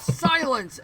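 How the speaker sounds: sharp, loud command; yell. Acted. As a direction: raise pitch and volume to silence someone. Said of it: "And she yells".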